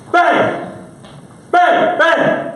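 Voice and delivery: shouted high bangs like gunfire; gunshot sounds. Pretend. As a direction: fire off each bang loud and high. Imitating gunshots.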